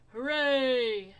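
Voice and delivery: very loud cheer; yelled hooray. A narrator's reading. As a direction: cheer with all your breath. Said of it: "yelled Bear".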